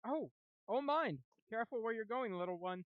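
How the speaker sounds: high, loud startled exclamation; startled bard. Acted. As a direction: jump back and warn her off, high and loud. Bard startled by goblin girl.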